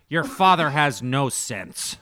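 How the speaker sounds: loud, slow mock scolding; imitated mother. Mom complaining to son about dad, loud mock scolding. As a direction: declare it emphatically as an exasperated mom. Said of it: "then she'll always say".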